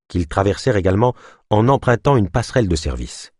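ordinary delivery, nothing marked

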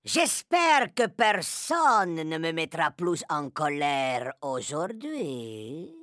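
low, softening threat; witch tyrant warning the room, slowing at the end. A narrator's reading. Narrator voices the Grand High Witch.

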